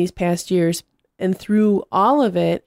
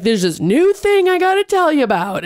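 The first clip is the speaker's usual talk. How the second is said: high-pitched mock excitement; imitating excited guy; 9 semitones higher, 3 dB louder than usual. Excited guy teaching the business class a new thing, mock excitement. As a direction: play an eager presenter bursting with news. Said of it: "he's all excited".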